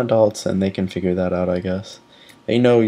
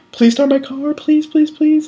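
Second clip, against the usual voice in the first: much higher, begging please start my car; pleading prayer. Retold or imitated. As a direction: beg the car like a desperate prayer. Praying car will start.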